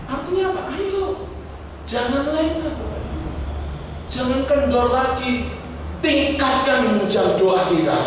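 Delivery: rally them, slow and emphatic on each plea; high fervent preacher's call; preaching at full force. Acted. Preacher urging the congregation.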